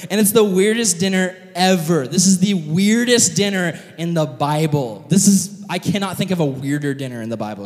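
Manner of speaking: normal talking voice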